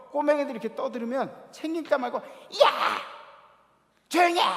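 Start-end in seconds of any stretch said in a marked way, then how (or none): 2.2-4.4 put-on sudden furious yell at kids; angry shout. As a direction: bark it out at full volume, higher and louder. Lady yelling at noisy kids. (버럭 소리 지르기)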